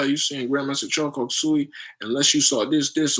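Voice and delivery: ordinary talk, nothing marked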